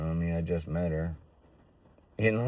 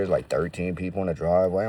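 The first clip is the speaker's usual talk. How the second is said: ordinary delivery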